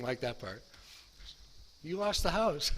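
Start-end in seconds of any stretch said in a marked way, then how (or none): 1.8-2.7 higher, pointed mock of the kids; kids' accusation. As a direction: throw it back at dad. Kids throwing the loss back.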